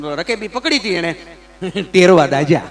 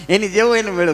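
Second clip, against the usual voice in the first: ordinary delivery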